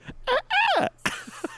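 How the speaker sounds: high squealing dolphin laugh; squeaky dolphin chirps; dolphin squeak. Acted. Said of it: "Oh, that was perfect".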